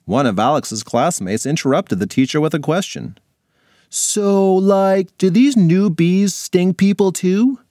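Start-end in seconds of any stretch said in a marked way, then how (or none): 3.9-7.6 high, slow curious classmate; a kid asking something, higher and slower; kid's voice. Acted. Classmate asks teacher a question.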